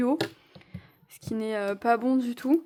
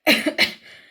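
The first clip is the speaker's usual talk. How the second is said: cough; 4 semitones higher, 10 dB louder than usual. Real, not acted. Coughing during workout.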